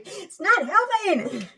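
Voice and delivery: high, slow, voice breaking with feeling; emotional cracking plea. Real, not acted. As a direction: let the voice crack on it. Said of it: "I get so emotional".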